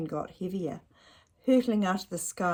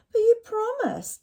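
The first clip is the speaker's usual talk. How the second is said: put-on much higher, louder child's cry; whiny child protest; 9 dB louder than usual. Ned protests to Dad about the broken promise, high and whiny. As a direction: protest like a let-down boy. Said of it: "said Ned".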